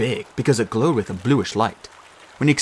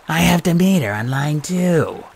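snake voice; 6 semitones higher, slower than usual. Acted. Martha snake voice.

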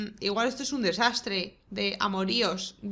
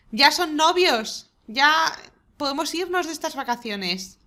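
proud announcement; 5 semitones higher, 5 dB louder than usual. Real, not acted. Triumphant announcement.

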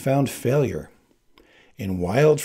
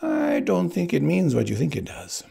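high-pitched, testy Spaniard; snapped retort; 8 semitones higher than usual. A narrator's reading. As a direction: answer back testily, pitched well up. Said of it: "Spaniard snapped".

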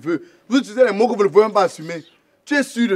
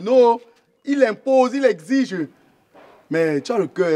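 normal talking voice

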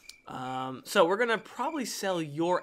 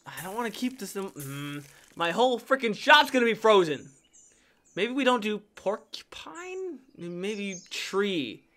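ordinary talk, nothing marked

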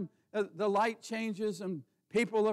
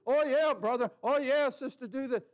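higher, louder mock cheer from friends; eager friends egging on; 6 semitones higher, 5 dB louder than usual. Preacher mocks friends' eager urging, higher and louder. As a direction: ham up the pushy friends cheering you on.

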